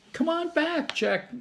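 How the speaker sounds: high shout calling him back. A narrator's reading. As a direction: call him back across the beach. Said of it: "Annie shouted".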